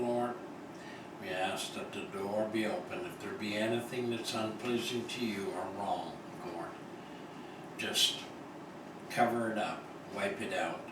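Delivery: normal talking voice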